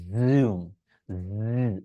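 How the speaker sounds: জুম with a low buzzing z; buzzing z demo. Shown as a demonstration. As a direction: demonstrate the voiced z in জুম. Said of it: "এই সাউন্ডটা করার চেষ্টা করবেন". (জেড ধ্বনির উদাহরণ)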